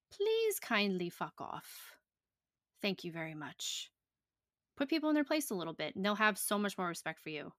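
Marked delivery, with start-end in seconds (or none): 0.1-3.9 slow, sugary fake courtesy, fading softer; overly polite fuck-off; mock polite. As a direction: say it sweetly while telling them off. Mock-polite dismissal of critics.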